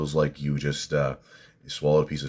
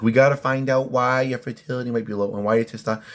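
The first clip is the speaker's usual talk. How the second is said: mocking the doctor; 8 semitones higher, 3 dB louder than usual. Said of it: "she's like".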